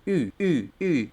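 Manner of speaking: short, repeated German ü vowels; sonido de U umlaut. Shown as a demonstration. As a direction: say I with lips rounded for U. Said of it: "Pones la boca para pronunciar la U". (vocal ü alemana, labios de U diciendo I)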